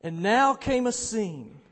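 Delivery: loud, rolling, impassioned storytelling; dramatic reading. Acted. Preacher reading Muir's account of celebration, impassioned. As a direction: read it out with swelling excitement.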